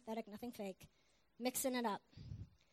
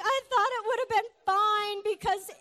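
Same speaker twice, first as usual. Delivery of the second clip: loud, high mock breakdown; hammed-up distraught outburst; a total wreck; 7 semitones higher, 9 dB louder than usual. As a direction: blurt out excuses like a man falling apart. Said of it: "He was a wreck".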